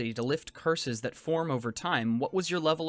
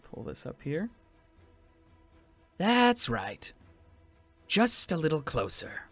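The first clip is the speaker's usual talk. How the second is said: far, eerie voice from the dark; luring from the shadows; dark whisper behind you. Acted. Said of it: "you hear a voice from the darkness".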